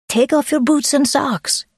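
put-on high Swiss-accented instruction; Susanna, Swiss accent. Swiss woman telling hiker to bare her feet, Swiss accent. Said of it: "I am from Switzerland".

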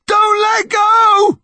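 high, drawn-out yell; desperate yell. A narrator's reading. Mum hanging from Connor's hands, long desperate yell. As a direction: plead at full cry. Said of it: "his mum yelled".

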